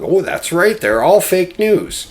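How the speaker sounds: high, gushing mock voice; gushy follower. Lemmings agreeing with Trump, high mocking gush. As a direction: coo it like a fawning fan. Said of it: "get all warm and fuzzy and gushy and go".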